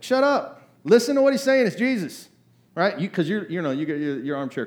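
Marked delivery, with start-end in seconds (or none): 0.0-2.3 much higher, louder frustrated yell; exasperated shout. Retold or imitated. Frustrated listener yelling at Peter, exasperated shout. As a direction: snap at Peter to just listen. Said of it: "you wanna smack him".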